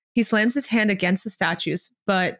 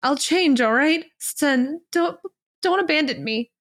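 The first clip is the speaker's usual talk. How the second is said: higher-pitched, halting plea; close to sobbing; 7 semitones higher than usual. A narrator's reading. Disciple begging not to be abandoned, nearly sobbing. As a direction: plead brokenly, stumbling on the words. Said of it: "Lo Binghe is close to sobbing".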